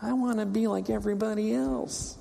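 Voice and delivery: put-on high, soft, whining complaint like a sulking child; whiny voice. Israel begging for a king like other nations, whiny. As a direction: whine it like a kid who wants what others have. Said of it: "I'll say it in a whiny voice".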